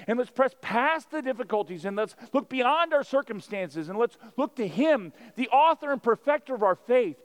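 loud, high, driving sermon delivery; full-force preaching. Acted. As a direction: preach it out to the whole room.

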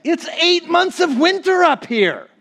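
high, slowed mock voice for Walt; Walt's voice. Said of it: "I heard Walt thought about it".

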